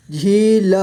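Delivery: झील split into झी, ल; sounded out. Shown as a demonstration. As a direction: stretch झी, then ल apart in झील. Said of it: "इस तरह से आपको". (झील को तोड़कर: झी, ल)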